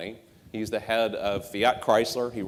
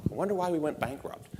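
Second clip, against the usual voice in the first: dry, higher, softer mock wonder; deadpan sarcasm, pitched up and hushed; sarcastic; 6 semitones higher, 5 dB quieter, faster than usual. Real, not acted. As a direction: feign innocent puzzlement. Sarcastic aside about selling at loss.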